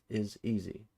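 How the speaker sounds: usual speaking voice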